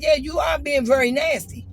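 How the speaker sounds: loud, high insistence; heated accusation. Retold or imitated. Telling the bartender she is very nasty, loud and heated. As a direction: hammer the point home loudly.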